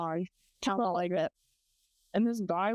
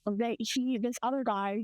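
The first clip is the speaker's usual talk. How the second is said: normal talking voice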